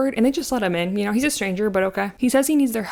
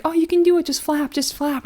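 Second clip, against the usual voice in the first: mock cheering girls; 8 semitones higher than usual. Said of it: "being like".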